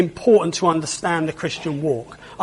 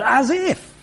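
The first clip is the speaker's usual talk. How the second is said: dismissive as if; 7 semitones higher than usual. Pretend. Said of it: "Whatever you might say".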